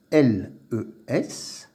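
ordinary delivery

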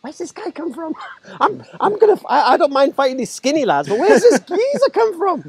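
high, laughing alarm at the newcomer; laughing disbelief. Retold or imitated. Man recalling a stocky geezer appearing, high laughing disbelief. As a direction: laugh through the shock of a bigger opponent.

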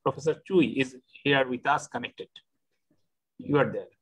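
usual speaking voice

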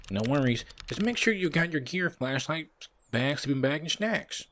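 high-pitched helpful buddy voice; high put-on game character voice; Bob. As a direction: pitch it up for a sidekick running through a checklist. Voices Bob listing gear.

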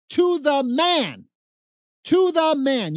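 slow, loud, every word punched; emphatic insistence. Real, not acted. Telling the host every leader said it, loud and slow. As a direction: drive it home word by word. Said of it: "emphatic repetition".

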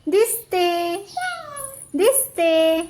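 high, loud, drawn-out "दिसते" for her to copy; mother prompting her daughter to repeat the words; prompting baby talk. Shown as a demonstration. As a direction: model the words slowly for a toddler. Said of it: "विचार, मी कशी दिसते".

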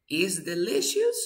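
is delicious with a rising, asking tune; questioning rise. Shown as a demonstration. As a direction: try it out as a question. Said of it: "¿Qué opinan?".

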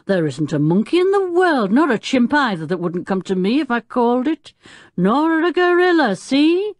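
lightly voiced big showman; a hint of blustering old man; boastful circus man. A narrator's reading. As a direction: boast with rising emphasis. Circus man boasting about monkeys.

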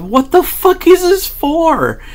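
high, slow and drawn out, half laughing; amused disbelief. Retold or imitated. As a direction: ask the empty kitchen, baffled and tickled. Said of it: "I literally say out loud".

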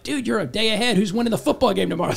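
lively, fast joking call-out; excited quip. Retold or imitated. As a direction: tease the listener a day ahead, quickly. Quoting himself to Australian listener.

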